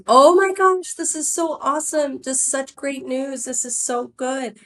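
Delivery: high squeal, easing down as it goes; gushing excitement. Retold or imitated. Boss hearing the Walmart news on the phone, gushing high. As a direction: re-enact an overjoyed, high-pitched reaction. Retold reaction to great news.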